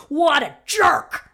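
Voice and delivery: loud, high, drawn-out outrage on a friend's behalf; hammed-up scandalised exclamation; mock indignation. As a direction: side with your friend, stretching each word. Acts out siding with her friend.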